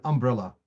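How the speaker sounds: "umbrella" stressed on the first syllable, UM-brella; misplaced stress. Shown as a demonstration. Teacher giving students an English example, misplaced stress on "umbrella". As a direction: put the weight on the opening syllable of "umbrella". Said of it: "You don't understand what I'm talking about".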